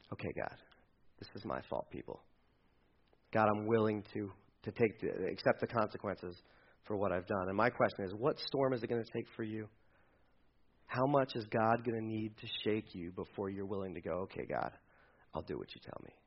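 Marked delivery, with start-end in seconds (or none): 0.1-7.5 very soft, low confession to God; near-whispered prayer of surrender; hushed surrender. Acted. 14.2-16.1 very soft, low yielding; barely voiced giving-in; hushed surrender. Imagined surrender to God.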